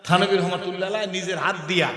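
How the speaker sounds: ordinary talk, nothing marked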